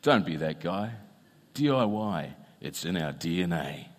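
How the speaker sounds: low, soft voiceover sign-off; advert voiceover. Retold or imitated. Speaker quotes hardware ad's slogan, in low voiceover voice. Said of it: "the voiceover begins".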